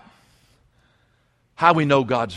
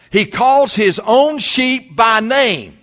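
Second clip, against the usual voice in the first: slow, louder, every word weighed; slow emphatic reading; 6 semitones higher, 7 dB louder, much slower than usual. Acted. Preacher to his congregation, slow and emphatic. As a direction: lean on each word of the promise.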